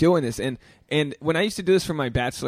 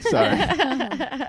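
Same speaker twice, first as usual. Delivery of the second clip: laughing; 12 semitones higher than usual. Real, not acted. Apologizing for cutting out.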